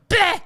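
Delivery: sudden high, loud "bleh" blurt; imitated wordless noise. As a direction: mimic an old woman's odd outburst. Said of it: "just literally making noises".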